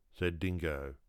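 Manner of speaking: ordinary delivery, nothing marked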